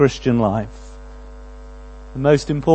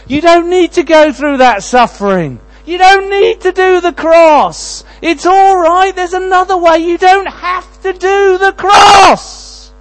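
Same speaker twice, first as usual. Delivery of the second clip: loud, high, coaxing tempter voice, slowing into a shout; Satan tempting; 9 dB louder, slower than usual. Acted. Satan tempting Jesus in the garden, loud coaxing shout. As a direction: lure him away from the cross, building to a shout. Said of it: "of Satan here".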